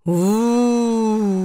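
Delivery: langgezogenes, lautes Geisterheulen; gespenstisches Uh. Acted. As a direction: wie ein Gespenst aus dem Haus heulen. Geräusch aus dem verlassenen Haus. (Gespenst im verlassenen Haus heult die Jungen an, lautes Uh)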